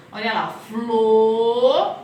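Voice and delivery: "flor" drawn out slow and loud; slow syllable demo. Shown as a demonstration. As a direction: sound out "flor" as one chunk. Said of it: "fica junto".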